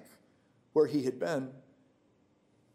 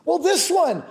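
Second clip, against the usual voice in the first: fervent preaching; 7 semitones higher, 5 dB louder than usual. Real, not acted. Preacher proclaiming God's faithfulness to the congregation, fervent. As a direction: preach it out with full conviction.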